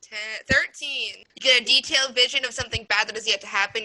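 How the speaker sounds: ordinary talk, nothing marked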